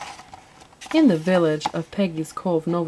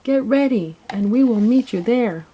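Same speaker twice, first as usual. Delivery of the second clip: high, loud rallying call; announcing; 5 semitones higher, 5 dB louder than usual. A narrator's reading. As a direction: summon the helpers from afar. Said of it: "she announced".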